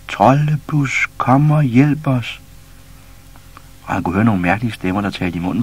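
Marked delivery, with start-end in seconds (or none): 0.1-2.4 slow, drawn-out high calls; distant calling. A narrator's reading. Caged animals keep calling for Troldepus, drawn out. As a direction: call long and slow from far away. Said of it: "Dyrene råbte jo stadigvæk".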